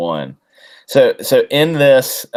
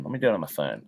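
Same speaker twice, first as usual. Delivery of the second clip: muttered to himself; 6 semitones lower, 10 dB quieter, much faster than usual. Real, not acted. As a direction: lower and much softer, half to himself. Streamer repeats to himself.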